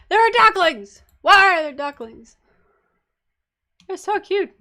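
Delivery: high, loud burst of delight; pitched-way-up gushing; excited squeal. Real, not acted. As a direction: exclaim over something adorable. Spots ducklings in the game.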